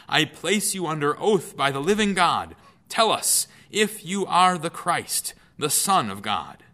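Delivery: a high priest forcing the answer; solemn charge. Acted. High priest adjures Jesus.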